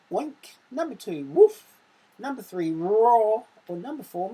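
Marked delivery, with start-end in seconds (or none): 1.2-1.7 much higher, much louder woof like a bark. Shown as a demonstration. Teacher acting out the dog sound for pupils, a loud high bark. 2.7-3.4 much louder roar; roaring word. Shown as a demonstration. Teacher voicing the roar option in the quiz, loud. Reading animal sound option.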